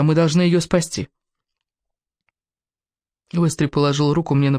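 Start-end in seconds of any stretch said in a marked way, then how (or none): none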